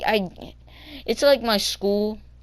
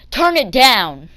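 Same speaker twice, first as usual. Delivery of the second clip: loud, higher mock command; imitated stern order; 5 semitones higher, 9 dB louder, slower than usual. Bus driver to kid with music on, loud mock order.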